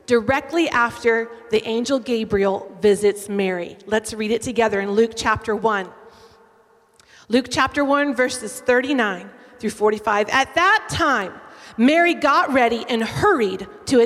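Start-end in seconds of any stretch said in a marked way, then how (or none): none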